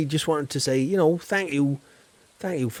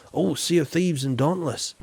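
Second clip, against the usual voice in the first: ordinary delivery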